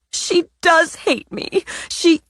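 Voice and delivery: high, whining, leaning on does; tearful insistence. Acted. Natalie insisting her sister hates her, high and tearful. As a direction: wail it through tears.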